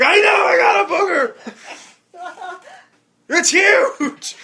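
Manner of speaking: high, loud, half-crying yell; mock outraged wail. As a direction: wail it in exasperation. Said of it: "I'm like".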